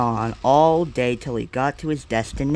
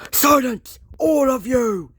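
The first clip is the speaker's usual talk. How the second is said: higher, sharp angry command; Henry silences the others, angry; 10 semitones higher than usual. A narrator's reading. Engine silencing the shed, angrily.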